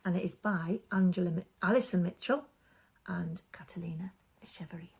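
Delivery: soft, hushed bedtime introduction; hushed story intro. Acted. Storyteller to children, hushed and soft. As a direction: ease listeners in gently.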